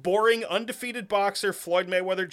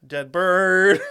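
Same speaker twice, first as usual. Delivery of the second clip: mock announcement; 9 dB louder than usual. Quoting Dumb and Dumber.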